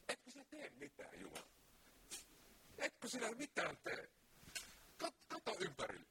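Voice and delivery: high, urgent plea to God; pleading prophet. Retold or imitated. Preacher voices Habakkuk crying out to God, pleading.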